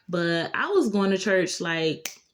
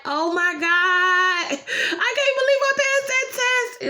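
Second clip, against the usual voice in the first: very high, slow, ecstatic squeal; jumping outside, screaming with joy after passing; overjoyed; 12 semitones higher, 4 dB louder than usual. Retold or imitated. Celebrating passing the test.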